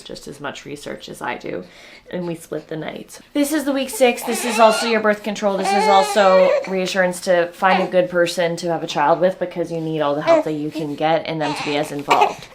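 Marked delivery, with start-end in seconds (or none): 3.3-12.5 high, loud, half laughing, half crying; overwhelmed and near tears; exhausted mom laughing through tears. Real, not acted.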